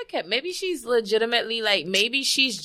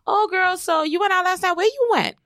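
put-on high-pitched prying girlfriend voice; nosy gossip; 11 semitones higher than usual. Nosy friend pumping someone with follow-up questions, high-pitched mimicry. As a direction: imitate a gossip fishing for details.

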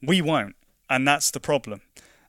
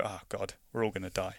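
mock despair; 5 semitones lower, 11 dB quieter than usual. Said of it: "And you go".

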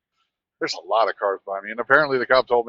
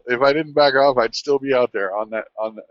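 ordinary delivery

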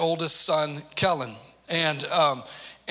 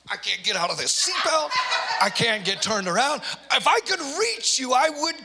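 higher, louder mock rage, half laughing; retold furious outburst; 8 semitones higher, 4 dB louder than usual. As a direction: replay the dad losing it from the front seat. Retold yelling at son from car.

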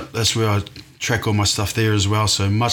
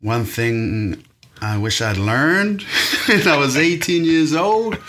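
put-on a light American accent, slow then laughing; mock American accent; 6 semitones higher, slower than usual. Answering the host's question in a mock American accent. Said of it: "I like your American accent".

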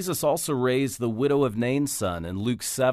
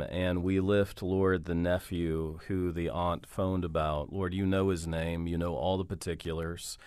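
hushed, earnest spoken prayer; 4 semitones lower, 4 dB quieter than usual. Real, not acted. Radio host praying for a caller's nephew, hushed and earnest. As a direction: pray softly over the caller's nephew.

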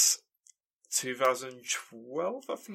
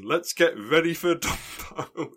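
high, slow, stretched announcer call; boxing ring announcer; 8 semitones higher, 4 dB louder, slower than usual. Retold or imitated. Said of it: "he's on there saying".

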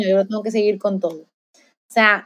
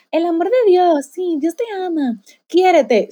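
higher, a sing-song preachy echo of the message she didn't believe; mock sermon voice; 7 semitones higher than usual. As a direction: parrot the slogan mockingly, pitched up. Se burla de sí misma como hipócrita. (imitando con ironía un sermón, en voz más aguda)

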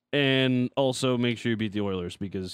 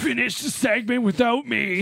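overacted death scene; 11 semitones higher, 5 dB louder than usual. Mock dying soldier after grenade joke.

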